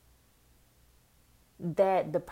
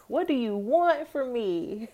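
exasperated; 5 semitones higher, 4 dB louder than usual. Retold or imitated. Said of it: "And I'm like".

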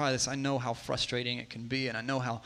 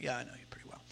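near-whispered retold reply; very soft, under the breath; hushed aside; 4 semitones lower, 14 dB quieter, much faster than usual. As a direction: drop it right down, almost no voice.

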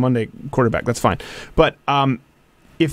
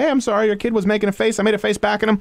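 apologetic explaining; 7 semitones higher than usual. Retold or imitated. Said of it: "I'll just clarify".

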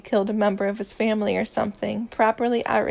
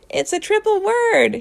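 high, loud protest with amusement; amused arguing; 11 semitones higher, 6 dB louder than usual. A narrator's reading. Louis argues over his triple word, loudly amused. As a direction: exclaim it high and loud, drawing out the last word. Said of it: "he argues with a loud laugh".

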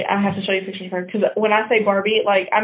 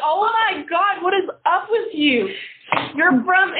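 laughing disbelief; 9 semitones higher, slower than usual. Retold or imitated. Retelling disbelief at the boyfriend.

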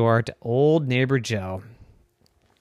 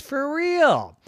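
for real jumps high; excited emphasis; 13 semitones higher than usual. A narrator's reading. Emphatic turn in story.

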